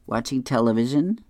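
plain reading voice

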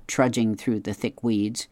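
ordinary delivery

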